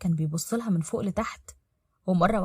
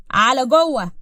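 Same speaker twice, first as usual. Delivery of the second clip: louder, higher command; curt order; 4 semitones higher, 8 dB louder, slower than usual. Acted. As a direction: send the child inside firmly. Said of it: "بصلها بهدوء وبعدين قال".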